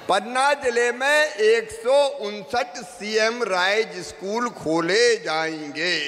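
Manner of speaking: slow, stretched-out announcement to the rally; slow proclamation. Real, not acted. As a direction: proclaim it word by word, dragging each one. Repeats the figure as proclamation.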